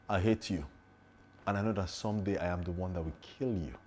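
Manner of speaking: quiet, menacing vow with falling endings; intense threat. Shown as a demonstration. Actor demonstrates the strongest take of a threatening line, quiet and intense. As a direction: say it softly, with controlled menace. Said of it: "which of them do you think is the strongest".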